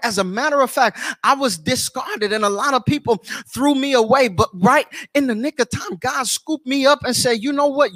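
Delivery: impassioned preacher, voice up and driving; fervent preaching. Real, not acted. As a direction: preach it with rising conviction, phrase after phrase. Preacher testifies at full force.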